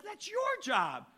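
retold high indignant retort; incredulous protest. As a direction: toss it back in disbelief. Protesting friend's request, high.

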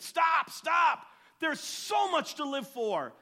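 high, loud cry to stop; shouted plea. Retold or imitated. Preacher shouting at a man on a cliff, loud.

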